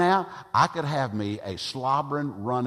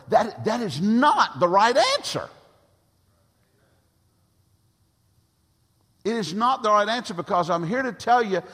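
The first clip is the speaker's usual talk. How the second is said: loud, high, insistent preaching; full-force preaching; 7 semitones higher, 6 dB louder than usual. Real, not acted. Preacher rebuking parents and churches, loud and rising. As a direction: hammer the point home from the pulpit.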